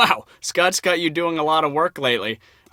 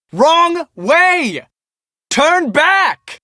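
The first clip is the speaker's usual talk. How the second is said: calling out; 9 semitones higher, much slower than usual. Acted. Warning a photographer heading the wrong way, calling out. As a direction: shout a warning from afar.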